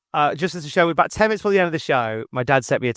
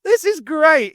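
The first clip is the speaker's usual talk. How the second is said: higher, louder delight; gleeful reaction to hearing Les Ross, higher; excited; 14 semitones higher, 5 dB louder, slower than usual. Retold or imitated. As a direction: burst out happily. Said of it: "And I was like".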